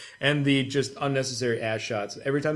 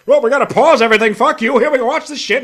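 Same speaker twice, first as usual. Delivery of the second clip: fast, loud, higher mock shout; mock game intrusion; 11 semitones higher, 12 dB louder, faster than usual. Mocking a game that takes away control, fast loud high mock shout. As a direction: bark it like a pushy game.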